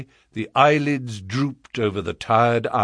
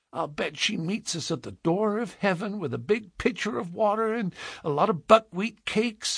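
put-on high, soft childish prattle; small girl's piping voice, trusting; innocent little girl; 8 semitones higher, 5 dB quieter than usual. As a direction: dream aloud happily of a treat. Child prattles about heaven.

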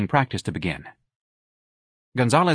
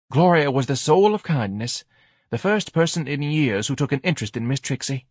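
higher-pitched, dramatic reproach; aggrieved wife; 5 semitones higher than usual. A narrator's reading. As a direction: lament the wrong and blame the husband. Wife laments and accuses husband.

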